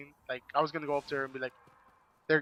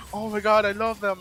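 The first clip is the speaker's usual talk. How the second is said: mock gush, much higher and louder; delighted gush; 7 semitones higher, 8 dB louder than usual. Kara opens the earrings, gushing with delight. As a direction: imitate her thrilled delight.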